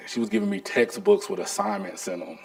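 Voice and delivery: ordinary talk, nothing marked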